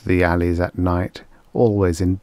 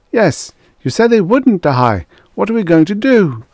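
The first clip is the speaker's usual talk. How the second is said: much higher and louder, near hysterical; frantic plea; 12 semitones higher, 6 dB louder than usual. A narrator's reading. Prime Minister begs the Emperor for a plan, near-hysterical high cry. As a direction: beg for an answer in rising panic.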